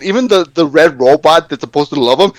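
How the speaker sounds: normal talking voice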